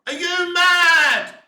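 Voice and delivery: slow, loud, high disbelieving yell; incredulous shout. Real, not acted. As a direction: drag it out in disbelief. Ranting at producers.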